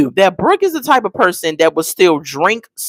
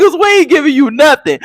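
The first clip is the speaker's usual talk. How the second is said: laughing while quoting; 7 semitones higher, 4 dB louder than usual. Retold or imitated. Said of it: "he said".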